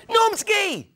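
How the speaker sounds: very high, loud delighted squeal of the name; surprised shriek. Retold or imitated. [name] shrieks back in surprise.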